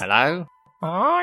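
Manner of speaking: lightly croaked raven greeting, lifting high at the end. Acted. Raven answering a visitor's hello, raven voice. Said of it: "That is an excellent raven voice".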